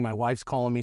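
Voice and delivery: ordinary talk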